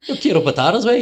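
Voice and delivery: high, loud Irish-accented Spanish. Shown as a demonstration. Showing off to co-host, high Irish-accented Spanish. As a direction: lift it into a jokey Irish lilt. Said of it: "I can do it pretty well".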